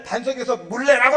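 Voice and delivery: loud, high irritated order; acting out Moses demanding water in a temper; angry command. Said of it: "기분이 굉장히 나쁜 거예요".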